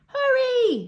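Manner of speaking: high loud urgent shout. Acted. Rainbow Fish shouts to the school to charge the shark. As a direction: yell it at the whole school. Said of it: "shouted Rainbow Fish".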